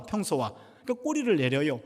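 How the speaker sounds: normal talking voice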